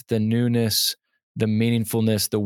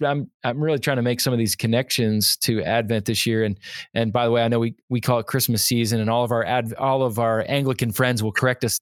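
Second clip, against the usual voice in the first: usual speaking voice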